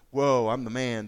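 loud, swaggering brag; cocky boast. Retold or imitated. Preacher voicing Peter boasting, loud cocky swagger. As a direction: crow it like you're the big man. Peter's bravado after the promise.

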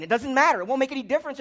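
hammed-up cry that it's no use; loud mock protest of the faithless; exasperated outburst. Voicing the complainers' attitude.

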